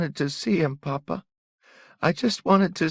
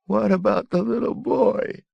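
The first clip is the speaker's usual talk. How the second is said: very high, loud wailing cry; louder sobbing boy; 9 dB louder than usual. A narrator's reading. Young son wailing at his father, very high. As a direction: let it break into a wail. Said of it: "he sobbed".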